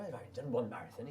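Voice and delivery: soft attempt at a Welsh accent. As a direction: quote him quietly with a hint of Welsh. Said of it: "that's my Welsh accent".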